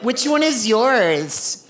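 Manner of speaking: mock-friendly playground small talk; chatty mom. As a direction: stretch it out sweetly like a nosy parent. Nosy mom makes small talk.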